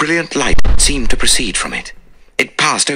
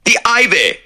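louder, urgent exclamation; cried out; 4 dB louder than usual. Acted. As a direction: call it out to calm them. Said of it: "I cried".

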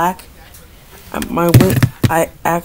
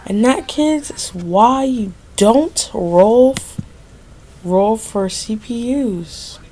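mock moral; 4 semitones higher, slower than usual. Mock lesson to viewers after losing.

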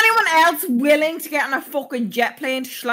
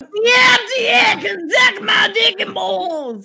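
put-on louder, slower New York accent; exaggerated New York accent; 6 semitones higher, 4 dB louder than usual. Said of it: "give you a second chance".

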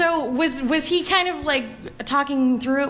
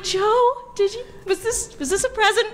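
much higher, gushing mock thanks; giddy gratitude; 9 semitones higher than usual. Actress thanking the writer for her murder scene, gushing. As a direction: gush at him like he gave you a present. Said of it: "you're like".

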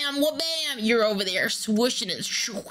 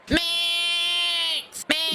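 calling out; 7 semitones higher than usual. Acted. Wife calls out for husband.

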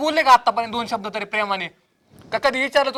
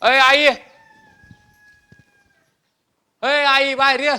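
raised, sustained call to someone indoors; calling out loudly; 3 semitones higher, slower than usual. Acted. Son calling his mother outside, hollering. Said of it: "काय झालं ग एवढं ओरडायला?".

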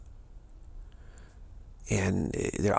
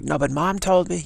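put-on high, louder kid protesting; whiny child; 8 semitones higher, 4 dB louder than usual. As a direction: answer back like a child caught out, higher. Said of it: "the father comes home and say".

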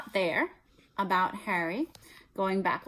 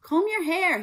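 uncle barks it, higher and louder; barked; 11 semitones higher, 6 dB louder than usual. Acted. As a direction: bark an order across the kitchen. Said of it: "he barked".

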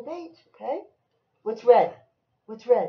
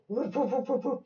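quick high little woofs; playful run of barks; dog imitation; 7 semitones higher than usual. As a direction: bark like a small dog. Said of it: "What's the dog say?".

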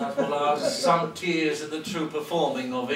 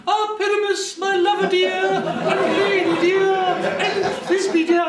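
monstrous little voice; 11 semitones higher than usual. Acted. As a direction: squeak it out like a dainty maiden. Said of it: "I speak in a monstrous little voice".

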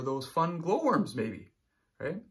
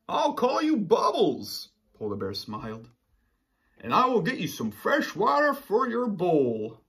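higher, louder gentle bear voice; Polar Bear voice; 4 semitones higher, 6 dB louder than usual. Acted. Teacher voicing Polar Bear naming his new fish, higher and louder. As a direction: play the cheerful polar bear.

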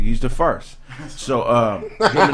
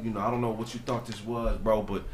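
quiet, low and stern; calm menace under the breath; low firm warning; 8 semitones lower, 11 dB quieter than usual. Retold or imitated.

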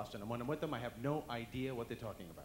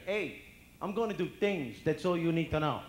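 loud, raised tough-guy brush-off; Italian president; 5 semitones higher, 11 dB louder than usual. Acted. Italian president dodging the press, loud and menacing. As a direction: shut the questioner down with swagger. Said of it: "If we had an Italian for president".

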